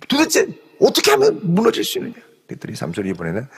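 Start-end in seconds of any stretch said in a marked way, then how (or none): 0.1-2.2 higher whiny plea, voice changed; 우는 척 하며 조르듯; mock weeping Delilah. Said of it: "들릴라가 막 울면서". (울면서 조르는 들릴라 흉내)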